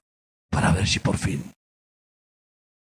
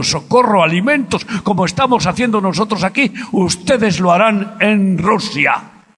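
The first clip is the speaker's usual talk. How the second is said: much higher and louder, crying it out; ringing prophetic call to the Ukrainians; impassioned prophetic shout; 13 semitones higher, 10 dB louder than usual. Retold or imitated. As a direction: preach it to the rafters. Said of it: "Y les dije".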